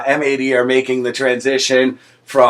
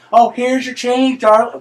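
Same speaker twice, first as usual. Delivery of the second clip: put-on Southern accent; 9 semitones higher than usual. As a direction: draw it out in a high Southern twang. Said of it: "pick up the accent".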